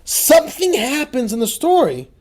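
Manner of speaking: fiery sermon delivery; preaching at full force. Acted. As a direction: rouse the congregation with the story.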